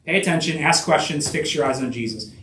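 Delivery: brisk run-through, falling away at the end; quick recap. Shown as a demonstration. Preacher recapping three steps for the congregation, quickly. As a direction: rattle off the list with little pause. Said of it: "Just real quick".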